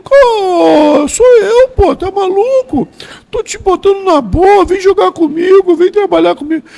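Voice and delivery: high, loud mock scolding voice; imitating Jair. Jair scolding Nelson over the phone, high mock voice. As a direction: squeal it out like an exasperated buddy. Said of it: "Ele falou".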